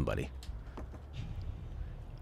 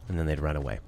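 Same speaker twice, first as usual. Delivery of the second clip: ordinary delivery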